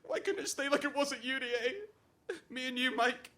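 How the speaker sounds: wistful pleading. Acted. Pining for university days with Mike.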